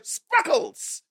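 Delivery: high, giggly mocking "Sparkles"; mock Jubilee's silly little cheer. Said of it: "she's like".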